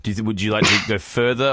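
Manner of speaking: usual speaking voice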